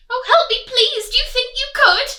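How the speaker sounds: high, loud, fast pleading piglet; frantic piglet; pig begging. Acted. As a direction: plead desperately.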